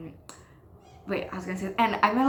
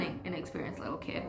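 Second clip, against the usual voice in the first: whispered, much softer; whispering; 2 semitones lower, 10 dB quieter than usual. Real, not acted. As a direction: drop to a whisper, admitting it. Self-deprecating admission.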